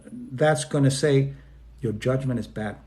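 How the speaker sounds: usual speaking voice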